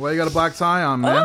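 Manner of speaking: confrontational street tough voice; tough guy. Pretend. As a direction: square up like a bar tough. Tough guy taunting the crisps.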